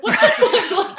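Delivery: high, laughing disbelief; shocked laugh. Retold or imitated. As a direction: squeal it out in shocked amusement. Retold shocked reaction.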